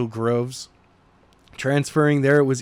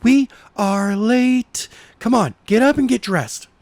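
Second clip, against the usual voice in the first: put-on a girlfriend hurrying him along; higher, urgent girlfriend voice; Jenna's voice; 9 semitones higher, 5 dB louder than usual. A narrator's reading.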